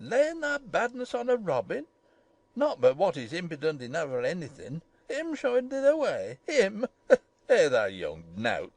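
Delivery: put-on old Yorkshire gardener, pitch swooping; outraged. A narrator's reading. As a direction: splutter indignantly in dialect, plunging low at the end. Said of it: "he was so outraged".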